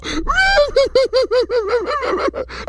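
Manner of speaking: high, hammed-up wail; exaggerated comic crying; mock wailing cry. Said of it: "started crying".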